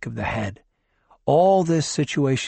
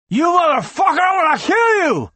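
much higher, louder raging yell; furious shout; 6 dB louder than usual. Acted. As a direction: scream the threat. Konopka threatens, fist drawn.

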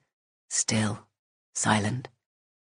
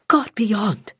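startled gasp of alarm; 10 semitones higher, 3 dB louder than usual. Acted. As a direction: blurt it out in shock. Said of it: "Silence gasped".

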